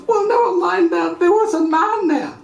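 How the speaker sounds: lightly voiced child. Girl insisting to her mother, light childish urgency. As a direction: hurry past the scolding. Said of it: "child answering mother".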